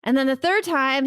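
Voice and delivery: normal talking voice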